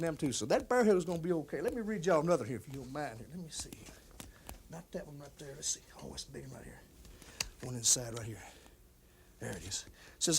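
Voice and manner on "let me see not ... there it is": hushed, absent-minded searching talk; muttering to himself. Acted. Man hunting through fan mail for a letter, muttering. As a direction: talk very softly to yourself while sorting.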